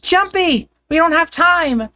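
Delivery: high, urgent back-and-forth calls; excited calling. Acted. Siblings calling to each other, high urgent calls. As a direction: reach him across the distance.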